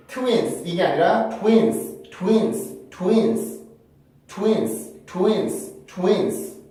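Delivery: twins with glided 투인스, stress on i; English pronunciation. Shown as a demonstration. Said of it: "이의 강세를 주셔야". (이에 강세 준 twins 반복)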